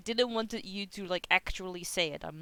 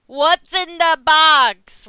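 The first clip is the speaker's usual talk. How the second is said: slow, booming and much higher, drawn out; movie quote; 9 semitones higher, 17 dB louder, much slower than usual. Retold or imitated. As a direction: bellow it like the climax of a thriller. Dramatic movie line quote.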